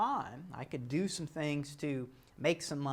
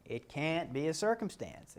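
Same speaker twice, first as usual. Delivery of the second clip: usual speaking voice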